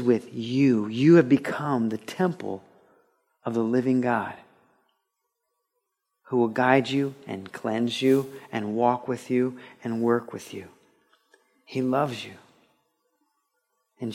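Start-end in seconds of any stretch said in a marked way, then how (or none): none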